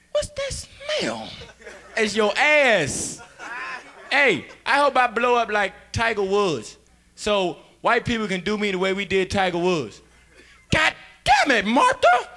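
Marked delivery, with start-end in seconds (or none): 0.1-1.3 much higher, slow puzzled voice; slow high bewildered question. Acted. Voicing confused person. 10.7-12.3 much higher, louder exasperated husband voice. Acted.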